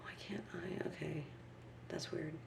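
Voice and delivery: quiet frustrated mutter; half-whispered under breath; muttering to herself. Real, not acted. Muttering at the computer.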